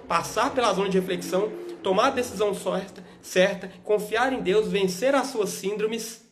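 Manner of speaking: fiery pulpit exhortation swelling up; preaching. Acted. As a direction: preach it harder and harder. Builds sermon to climax.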